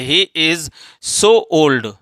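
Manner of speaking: very slow, word by word; deliberate classroom reading pace; slow dictation. Shown as a demonstration. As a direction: spell it out slowly for students. Teacher reads corrected sentence.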